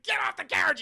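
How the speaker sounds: Tuco ordering Blondie off the carriage; furious yell. Retold or imitated.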